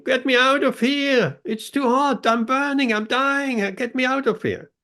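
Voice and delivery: hammed-up cry to get out of the heat; mock shouting vase. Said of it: "he was shouting".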